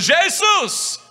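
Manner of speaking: high loud call; crying out. Acted. Congregation crying out to Jesus, loud call. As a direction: shout the name up to heaven. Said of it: "começar gritando".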